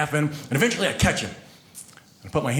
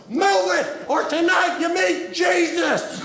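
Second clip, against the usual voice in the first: furious dad yells out the car window; yelling out the window; 13 semitones higher, slower than usual. Retold or imitated. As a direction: bellow it at the pedestrians. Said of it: "he just yells out the window".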